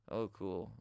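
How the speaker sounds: low, soft, uninterested drone; emotionless monotone. Shown as a demonstration. Streamer imitates a dull streamer reacting, emotionless monotone. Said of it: "Literally no emotion".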